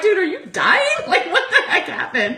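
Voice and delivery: alarmed laughing. Retold or imitated. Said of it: "I'm like".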